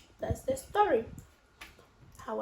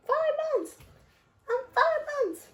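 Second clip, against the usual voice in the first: very high squeaky pet voice; ferret's voice; 5 dB louder than usual. Pretend. Owner speaking for her ferret, squeaky high pet voice.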